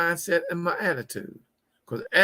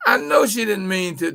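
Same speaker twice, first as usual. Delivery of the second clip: normal talking voice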